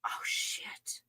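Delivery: stunned whisper. Real, not acted. Vlogger stunned by her realization, whispering. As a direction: breathe it out in shock.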